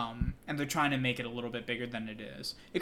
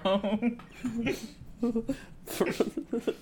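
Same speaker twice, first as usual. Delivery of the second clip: big laugh; 9 semitones higher than usual. Real, not acted. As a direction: burst out laughing.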